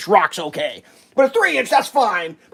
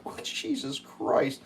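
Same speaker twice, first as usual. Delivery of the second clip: breathy exasperation; 8 semitones higher, 11 dB quieter, much slower than usual. Real, not acted. Exasperated sigh, half crying.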